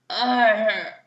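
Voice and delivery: drawn-out yawn sound; sleepy yawn. A narrator's reading. Daddy Pig yawns, just woken up by the kids. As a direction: yawn it out, half awake. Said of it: "yawned Daddy Pig".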